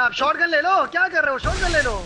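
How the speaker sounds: real heated game call; exasperated urging. Real, not acted. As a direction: push a teammate who is not listening. Scolding teammate during fight.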